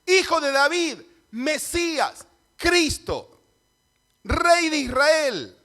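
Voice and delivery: high, loud cries, one title at a time; grito de Bartimeo. Acted. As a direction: shout each title, drawing out the last. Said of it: "lo que está diciendo Bartimeo es". (gritos agudos y fuertes)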